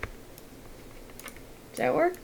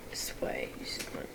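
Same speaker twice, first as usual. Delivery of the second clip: soft whisper to self; 17 dB quieter than usual. Real, not acted. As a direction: whisper it under your breath. Muttering while working on screen.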